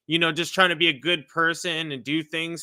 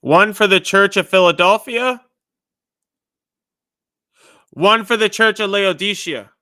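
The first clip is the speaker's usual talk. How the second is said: much louder, booming prophetic announcement; loud proclamation; 4 semitones higher, 9 dB louder than usual. Acted. Delivering God's message to the congregation, loud and booming. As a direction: declare it like a prophet to the crowd.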